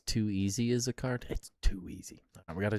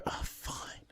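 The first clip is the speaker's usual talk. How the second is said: a sulky whispered okay; grudging whisper; 11 dB quieter than usual. Real, not acted. Told to get back to work, answering in a grudging whisper. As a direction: whisper it, giving in reluctantly.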